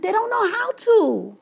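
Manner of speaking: lightly voiced young child explaining herself to her mother; small child voice. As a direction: answer like a helpless kid. Her childhood reply quoted.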